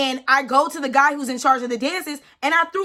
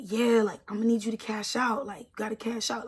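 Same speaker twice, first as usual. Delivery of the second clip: much softer, lower, hesitant; awkward mumble; 4 semitones lower, 9 dB quieter than usual. Retold or imitated. Security guard awkwardly tells her to cash out, mumbling. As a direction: mumble it like you wish you weren't saying it. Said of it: "standing there so awkward".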